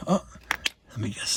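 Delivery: ordinary delivery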